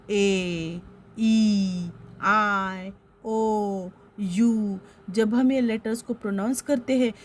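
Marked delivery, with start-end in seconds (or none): 0.1-4.8 "ए, ई, आई, ओ, यू" each drawn out separately; open-mouth vowel demo. Shown as a demonstration. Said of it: "आप बोल के देखो".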